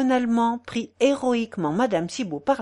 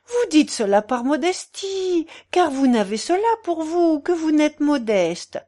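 higher, animated busybody; insistent portress; 9 semitones higher, 6 dB louder than usual. Acted. Portress refusing the sick man's modesty, insistent. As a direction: contradict him in a high, chatty voice.

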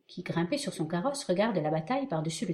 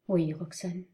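ordinary talk, nothing marked

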